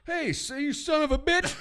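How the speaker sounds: high, loud mock rant at an employee; hammed-up angry customer shout; mock yell. Mock yelling at store employee.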